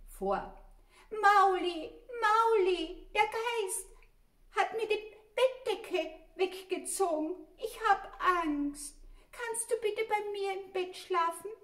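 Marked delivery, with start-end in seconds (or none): 1.1-11.5 hoch, laut und flehend; ängstliches Maulinchen. A narrator's reading. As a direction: bettle voller Angst um Hilfe. Verängstigtes Kind ruft Bruder. (hohe, laute, verängstigte Kinderstimme)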